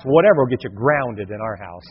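ordinary delivery

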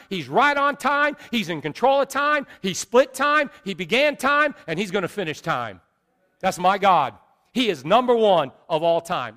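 loud, high rhythmic preaching; preaching at full force. Real, not acted. Preacher declares God is number one of all time, full-force preaching. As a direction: drive each phrase home to the congregation, building.

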